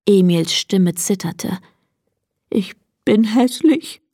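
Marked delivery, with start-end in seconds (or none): 2.5-4.0 a touch of sobbing, higher voice; mild sob. A narrator's reading. Donkey Emil confesses his hurt to his friend, mildly sobbing. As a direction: lightly sob it out, pitched up. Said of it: "schluchzte er plötzlich".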